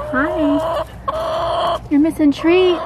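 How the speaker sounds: calling chickens. Real, not acted. Talking to chicken in high voice.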